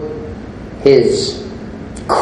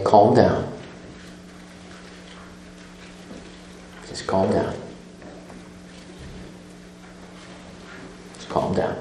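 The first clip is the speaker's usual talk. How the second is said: firm but quiet, fading softer with each repeat; firm self-talk; 3 semitones lower, 13 dB quieter than usual. Shown as a demonstration. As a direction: tell yourself firmly, with long pauses, getting quieter. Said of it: "very firmly".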